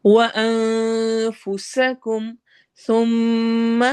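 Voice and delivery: slow chanted tajweed with a long drawn ha; Quranic recitation. Shown as a demonstration. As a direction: recite it, stretching the vowel. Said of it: "سا کو نرمی سے".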